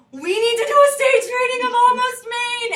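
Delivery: high, loud sudden burst of an idea; excited gasp. Retold or imitated. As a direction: gasp then blurt the idea out. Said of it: "And I just was like".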